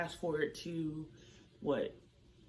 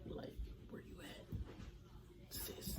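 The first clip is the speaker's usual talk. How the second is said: whispered plea; 10 dB quieter than usual. Pretend. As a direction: whisper it like calling someone who's gone missing. Whispered call to period.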